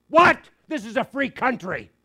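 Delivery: mock outraged yell, high and loud; indignant shout. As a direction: bark it back like an offended teenager. Boyfriend protesting on the call.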